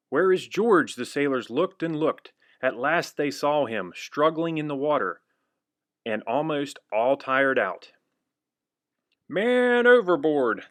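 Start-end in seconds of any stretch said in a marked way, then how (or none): 0.1-0.9 louder, higher call. Acted. Sailors calling out for missing George. 9.3-10.6 loud, high, slow alarm call; crying out. Acted. Sailors crying out as George falls overboard. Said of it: "the sailors cried".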